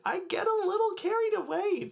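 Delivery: jumps way up into a falsetto squeal. Acted. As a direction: let it fly up high and squeaky. Excited self-description, voice flips up.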